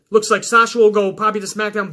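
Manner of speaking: ordinary delivery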